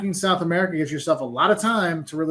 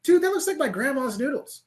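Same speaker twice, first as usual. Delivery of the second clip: higher-pitched amazed recognition; retold astonishment; 7 semitones higher than usual. Traveler recalling his first look at German noodles, amazed. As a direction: replay your surprise, pitched up.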